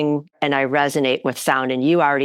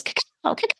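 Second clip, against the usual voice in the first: a breathy, unvoiced squawk of cacao, soft and clipped; bird call; 5 dB quieter than usual. Host imitating a bird squawking cacao, quiet and breathy. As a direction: mimic a bird croaking its name. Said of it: "a bird that goes".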